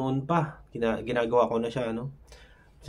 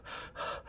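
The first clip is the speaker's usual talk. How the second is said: heavy forced exhale; 15 dB quieter than usual. Shown as a demonstration. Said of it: "hinga kang ganyan".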